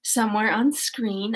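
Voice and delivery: ordinary talk, nothing marked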